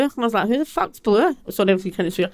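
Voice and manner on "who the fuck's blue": retold alarm. Retelling her panicked reply.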